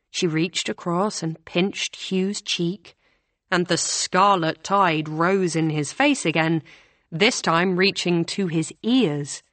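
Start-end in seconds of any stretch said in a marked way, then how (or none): none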